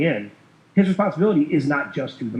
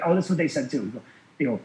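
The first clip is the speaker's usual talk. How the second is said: ordinary talk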